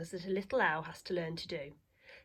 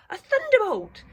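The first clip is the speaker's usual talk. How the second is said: startled cry; 11 dB louder than usual. Acted. Old lady startled by thump.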